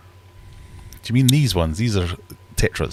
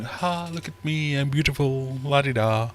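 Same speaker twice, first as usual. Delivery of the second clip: much higher, slower, preening voice; vain fish; 8 semitones higher than usual. Pretend. Discus admiring themselves in the tank, high and slow. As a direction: show off like a lazy beauty queen. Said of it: "They're very much going".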